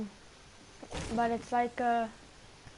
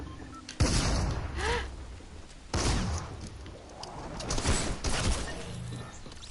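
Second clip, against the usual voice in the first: sharp, high unvoiced gasp of shock; 11 semitones higher than usual. Real, not acted. Player caught off guard in game, sudden gasp. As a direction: suck in air, startled.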